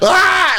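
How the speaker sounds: very high, short scream of alarm; panicked mock scream. Tripping festival-goer bailing from a stranger, mock scream. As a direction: shriek like you're about to bolt.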